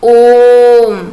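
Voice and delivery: ओम held very long and loud; prolonged vowel. Shown as a demonstration. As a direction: call ओम out like summoning someone. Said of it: "साथ में बोलिए". (ओम, प्लुत स्वर में ज़ोर से खींचकर)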